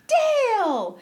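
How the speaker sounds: high, loud mock call; calling out Dale. Retelling the movie, Flash calls back to Dale, high loud call. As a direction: belt the name out high, reunited. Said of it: "And he's like".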